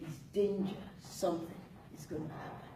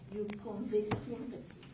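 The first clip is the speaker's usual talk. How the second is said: normal talking voice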